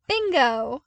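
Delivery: loud, high mock-cheerful shout; hammed-up celebration, not meant; false cheer. A narrator's reading. Luke mocking Zev with a loud fake cheer. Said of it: "in a show of false cheer".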